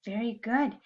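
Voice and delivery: ordinary delivery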